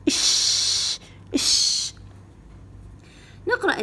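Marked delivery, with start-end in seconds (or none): 0.1-1.9 isolated "إش" hissed sound; sound of the letter. Shown as a demonstration. Teacher to pupil, showing the letter's sound, hissed. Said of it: "هذا بس باللون الأحمر".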